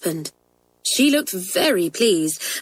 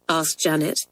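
ordinary delivery

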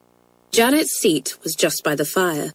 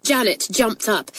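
plain reading voice

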